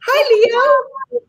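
high singsong pet voice; cooing pet greeting. Real, not acted. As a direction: squeal a drawn-out hello to a beloved cat. Greeting her favourite cat on camera.

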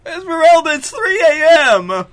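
very high, loud holler; hammed-up middle-of-the-night yell; exasperated employer. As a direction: shout it across the house, fed up. Acts woken employer yelling back.

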